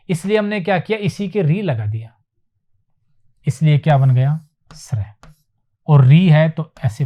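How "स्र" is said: whispered letter. Shown as a demonstration. Quietly showing a letter.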